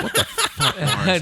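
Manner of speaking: high laughing mock mom scolding; laughing high mom voice scolding her son; imitating his mother. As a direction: squeal it like an outraged mother, laughing. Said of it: "She's like".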